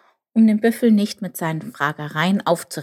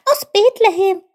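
high, loud excited squeak; Chip calling out; 9 dB louder than usual. A narrator's reading. Chip recognises the prophesied town, high loud call. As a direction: cry it out very high. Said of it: "rief Chip".

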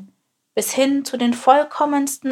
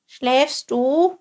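higher-pitched questioning call; raised, rising little voice; 6 semitones higher, 4 dB louder than usual. A narrator's reading. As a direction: call the question up high to a sleeper. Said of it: "rief Chilp".

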